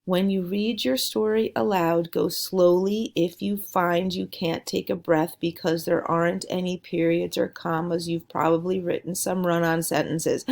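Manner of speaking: one breathless run with no pauses; a run-on read with no punctuation breaks; read without pauses. Shown as a demonstration. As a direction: rush it all in one breath, no stops. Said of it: "if I read it slowly and didn't have punctuation".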